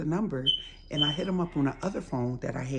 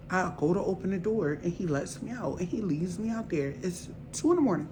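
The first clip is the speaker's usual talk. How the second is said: ordinary talk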